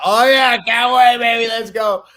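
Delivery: high, loud eager taunt; loud cocky challenge to the boss; eager hype. Retold or imitated. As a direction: bring it on, pumped and ready. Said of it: "I'm like".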